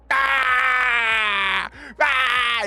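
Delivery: high, loud, drawn-out mock scream; imitated scream. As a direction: wail like a player downed mid-game. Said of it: "on the floor just going".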